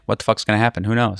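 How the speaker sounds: ordinary delivery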